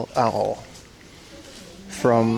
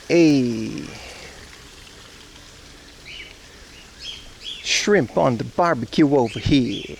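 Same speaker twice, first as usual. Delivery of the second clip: put-on Australian accent; slower than usual. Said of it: "Do an Australian accent".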